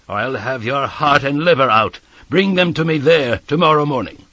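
building to a loud, high command; bully the boy with rising force; threatening convict. A narrator's reading. Convict orders boy to bring file and food, threatening.

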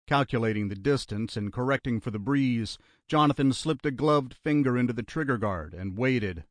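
usual speaking voice

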